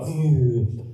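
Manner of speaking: loud, weary waking groan; acted morning "ugh"; groggy groan. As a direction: groan like a bag of potatoes. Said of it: "you feel like a bag of potatoes".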